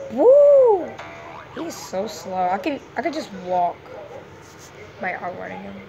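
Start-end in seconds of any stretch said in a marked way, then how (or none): 0.1-0.9 high, loud cheer; excited whoop. Real, not acted. Gamer whoops at his own good look. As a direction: let out a big happy woo.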